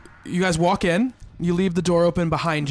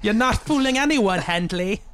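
crone voice; 4 semitones higher than usual. Acted. Crone speaking to Henley.

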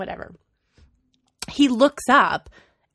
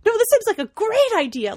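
mock eager reply; 13 semitones higher, 4 dB louder than usual.

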